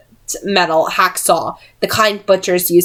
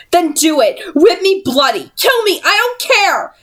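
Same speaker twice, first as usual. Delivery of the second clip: defiant shout; 12 semitones higher than usual. A narrator's reading. Tessa defies her captors with a high shout. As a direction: hurl it back at them, no fear left. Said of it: "Tessa shouted".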